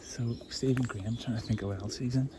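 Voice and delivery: ordinary talk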